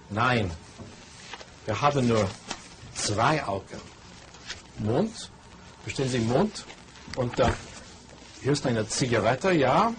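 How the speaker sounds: slow, clear German with long pauses between words; slow teacher German. Shown as a demonstration. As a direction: teach German word by word, as to a beginner, pointing at things. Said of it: "Here's lesson number two".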